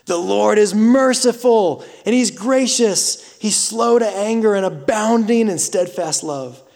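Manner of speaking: fervent pulpit reading; preaching scripture. Acted. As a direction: proclaim it to the whole church. Scripture read at full force.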